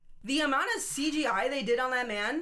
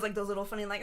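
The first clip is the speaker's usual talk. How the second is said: normal talking voice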